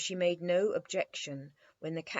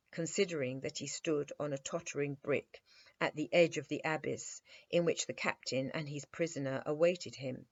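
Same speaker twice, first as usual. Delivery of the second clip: ordinary delivery, nothing marked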